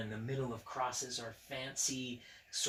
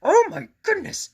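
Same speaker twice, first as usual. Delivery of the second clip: a much higher, louder panicked monster voice; Grover voice; 16 dB louder than usual. Acted. Grover jumping out of bed in alarm. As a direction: squeal it like startled Grover. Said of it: "he said, jumping out of bed".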